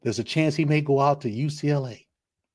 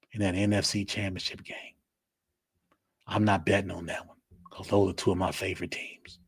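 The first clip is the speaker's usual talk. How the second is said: normal talking voice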